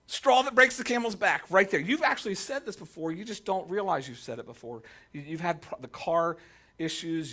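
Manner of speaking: usual speaking voice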